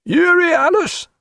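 high, slow rising hail; calling question. A narrator's reading. Sailor at bow port calling other ship, high questioning. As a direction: call the ship's name questioningly. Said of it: "a voice said".

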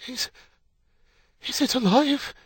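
halting, very high anxious question; gasping. A narrator's reading. New mother asking after her baby, gasping. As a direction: gasp it, faltering after the first word. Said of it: "she gasped".

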